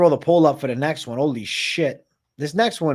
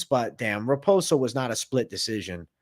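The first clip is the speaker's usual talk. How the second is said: usual speaking voice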